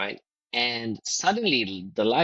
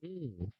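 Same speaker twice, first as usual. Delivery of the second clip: thinking hmm; 3 semitones higher, 15 dB quieter than usual. Real, not acted. Pondering the host's question.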